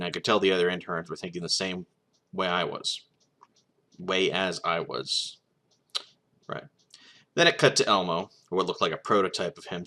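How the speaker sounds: ordinary delivery, nothing marked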